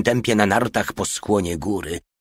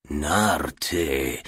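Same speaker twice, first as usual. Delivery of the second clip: low-key, musing murmur; pensive murmur; 3 semitones lower than usual. A narrator's reading. Commissar pondering the little one's report, murmuring pensively. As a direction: turn the word over in thought. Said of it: "mruczał w zamyśleniu komisarz".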